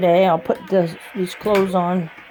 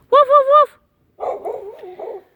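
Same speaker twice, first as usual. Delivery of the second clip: mock barking; much slower than usual. Barking at the dogs.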